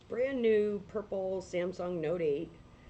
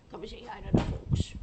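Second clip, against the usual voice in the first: calling the dog out, louder; 4 semitones higher, 5 dB louder than usual. Real, not acted. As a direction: call her over with more push. Coaxing dog, louder call.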